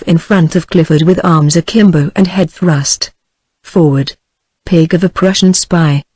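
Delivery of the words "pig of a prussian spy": ordinary delivery, nothing marked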